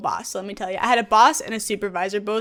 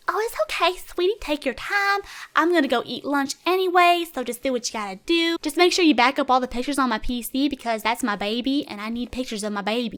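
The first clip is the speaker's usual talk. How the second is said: sweet Southern belle; 2 semitones higher than usual. Retold or imitated. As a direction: coo it like a sweet Southern grandma. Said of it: "sweet little Southern belles who are like".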